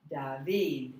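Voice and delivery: second syllable of David loud and long; stress on second syllable. Shown as a demonstration. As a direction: say David stressing the final VID. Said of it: "It's on the second syllable".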